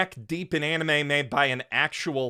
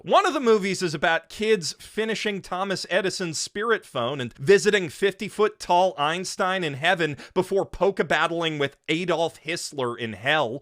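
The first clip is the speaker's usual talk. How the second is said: usual speaking voice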